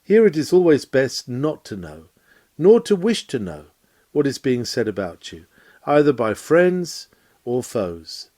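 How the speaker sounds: ordinary talk, nothing marked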